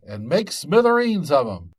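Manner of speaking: yelling fire at the top of the voice; shouted orders. Acted. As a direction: shout it to charge the attack. Jack shouting battle orders.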